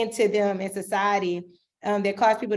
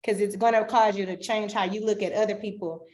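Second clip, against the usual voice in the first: usual speaking voice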